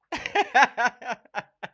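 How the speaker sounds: high, loud burst of laughter. Real, not acted. Streamer laughing loudly at the BBC claim. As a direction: cackle at the lie.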